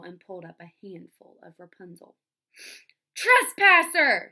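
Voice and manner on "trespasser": high, very loud, drawn-out accusation; shriek. A narrator's reading. Enchantress catching the man in her garden, shrieking. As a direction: shriek it at an intruder caught in the act. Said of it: "shrieked a voice".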